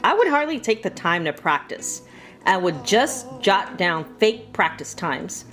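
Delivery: ordinary delivery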